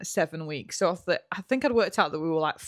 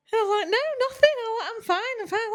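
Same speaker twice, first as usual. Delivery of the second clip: much higher, squeaky I'm fine; fake breezy denial; 14 semitones higher, faster than usual. Telling her mum on the phone nothing's wrong, squeaky fake-breezy voice. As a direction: chirp it out to hide the secret.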